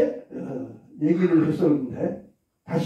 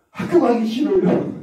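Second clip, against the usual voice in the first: put-on whiny child; 6 semitones higher, 3 dB louder than usual. Teacher voices child refusing school.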